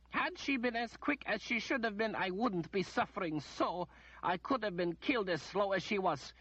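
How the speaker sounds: high, imperious noblewoman voice; a pampered noblewoman demanding treatment first; haughty lady. A narrator's reading. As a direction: play the spoiled lady complaining.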